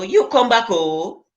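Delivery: normal talking voice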